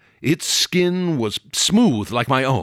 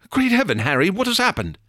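high, startled cry of alarm; 9 semitones higher, faster than usual. A narrator's reading. Hammond bursts in on Harry, alarmed cry. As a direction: call out in shock, pitched high.

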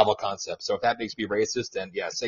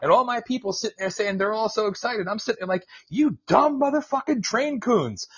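high, mocking retold rant; imitating his buddy; 12 semitones higher than usual. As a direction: quote the friend's scorn at the excited crowd. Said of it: "And he goes".

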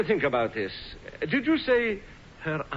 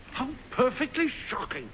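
higher, softer mock-scandalized gasp; 7 semitones higher, 4 dB quieter than usual. Uncle to niece, hammed-up scandalized. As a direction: relish the scandal while pretending shock.

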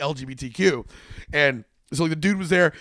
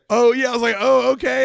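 high, loud mock-impressed reaction; retold surprise; 8 semitones higher, 7 dB louder than usual. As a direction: play up a sudden "oh, okay". Reacting to the lady's claim.

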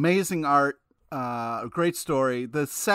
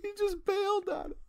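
laughing; 5 dB quieter, faster than usual. Real, not acted. Still laughing.

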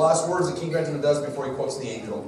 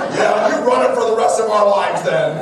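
loud sulky comeback with a laugh; mock huffy; 8 semitones higher, 11 dB louder than usual. Dad retorting to his kids who want Mom to lead, mock offended. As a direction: sulk back at the kids in jest. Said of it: "I was like".

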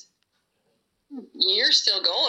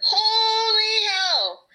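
high, slow, wailing yell; screaming crying fan; 10 semitones higher, much slower than usual. Real, not acted. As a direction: cry out in despair. Shouted in dismay.

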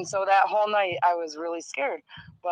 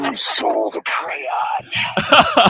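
whispered ghost voice; 3 semitones lower, 7 dB louder, much slower than usual. Pretend. Ghost voice bit continued.

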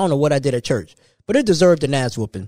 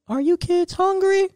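sweet hostess voice; 13 semitones higher, slower than usual. Retold or imitated. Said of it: "the mom's friend would test you and be like".

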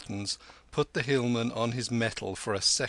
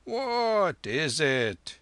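slow, drawn-out higher voice; old lama; 6 semitones higher, 4 dB louder, much slower than usual. A narrator's reading. Lama raising his head to the threatening hillman, slow question. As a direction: stretch each word as the lama.

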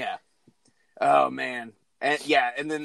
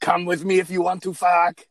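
Schwarzenegger impression; 3 semitones higher, 5 dB louder than usual. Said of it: "shitty Schwarzenegger impressions".

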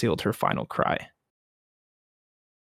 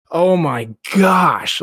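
slow, drawn-out oh my gosh, a notch higher and louder; shocked gasp; 5 semitones higher, 4 dB louder, much slower than usual. Real, not acted. Podcaster stunned by the tragedy, slow shocked oh my gosh. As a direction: let it sink in with horror. Reacting in horror to the story.

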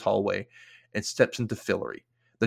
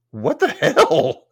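horrified; 12 semitones higher, 7 dB louder than usual. Real, not acted. Horrified reaction to death.

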